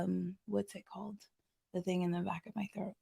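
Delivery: soft and fast, half to herself; quiet rushed mumble. Real, not acted. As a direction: mumble it quickly while searching for the word. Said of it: "searching for a word".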